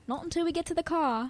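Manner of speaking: retold line in a light British accent. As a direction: answer in a mock British accent. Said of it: "I was talking in a British accent".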